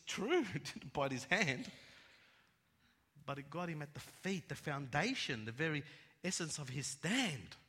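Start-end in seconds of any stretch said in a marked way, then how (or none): none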